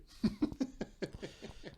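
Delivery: airy high-pitched laugh, no voice; high breathy giggle. Real, not acted. Laughing at the comic line with friends, high airy giggle. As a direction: giggle out breathlessly at the absurd quote.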